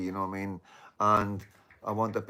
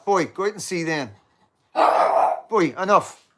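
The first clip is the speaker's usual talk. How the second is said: loud, higher call at the dog; scolding the dog; 8 semitones higher, 8 dB louder than usual. Real, not acted. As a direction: order the dog out firmly. Scolding the dogs mid-stream.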